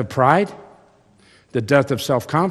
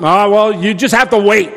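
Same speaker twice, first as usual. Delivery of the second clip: harried dad; 9 semitones higher, 10 dB louder than usual. Retold or imitated. Dad answering the kid.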